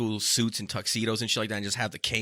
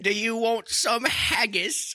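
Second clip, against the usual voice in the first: slow, higher mock Scottish voice; Scottish accent, slow and higher; 9 semitones higher, slower than usual. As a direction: offer it like a hammy Scotsman. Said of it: "That's Scottish".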